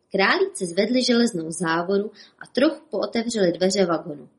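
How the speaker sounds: ordinary delivery, nothing marked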